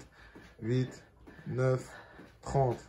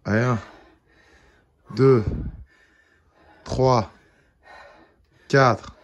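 loud, effortful counting through squats; doing squats; 9 dB louder, much slower than usual. Real, not acted. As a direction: push each rep count out hard. On récupère avec les squats.

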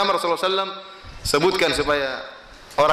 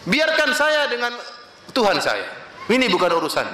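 scornful sinner's retort; 8 semitones higher than usual. Retold or imitated. Sinner brushes off his pious brother, high and mocking. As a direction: sneer it back at him, laughing. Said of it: "kata jawaban teman yang bermaksiat".

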